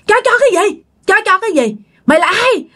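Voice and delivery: retold angry cursing. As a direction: hurl abuse at the intruder. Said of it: "Ba chửi nha".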